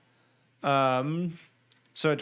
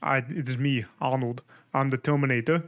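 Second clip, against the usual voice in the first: heavy Austrian Arnold voice; doing Arnold Schwarzenegger's Austrian accent; Arnold impression. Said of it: "my favorite's Arnold".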